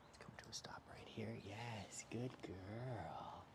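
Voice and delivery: soft unvoiced whisper. Real, not acted. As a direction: whisper so the dog stays calm. Whispering near the dog.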